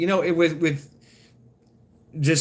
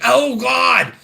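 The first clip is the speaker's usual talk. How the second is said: loud, high desperate "Oh, God!"; urgent cry; 8 semitones higher, 7 dB louder than usual. Retold or imitated. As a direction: blurt it out like you can't hold it. Roommate bursting in desperate.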